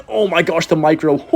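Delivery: high, thrilled exclamation; amazed excitement. Real, not acted. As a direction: burst out high, amazed by the play. Amazed at own play.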